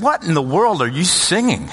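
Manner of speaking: incredulous brother. Retold or imitated. Older brother challenging the kid singing, incredulous.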